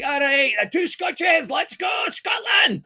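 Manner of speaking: high, hollered mock-Scottish accent; rowdy pub-crawl Scottish shout. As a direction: whoop it up as a fake Scot. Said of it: "talking is a Scottish accent all day".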